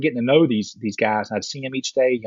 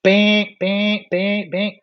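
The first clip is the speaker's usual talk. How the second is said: high, repeated radar bings; ping it out like an alarm going off; imitated radar alarm; 7 semitones higher, much slower than usual.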